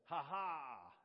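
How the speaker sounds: loud triumphant fencer's laugh line; Macduff. Acted. Macduff to Macbeth mid-fencing, loud stagey ha-ha. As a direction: crow a bold, loud ha-ha. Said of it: "that's how I picture it".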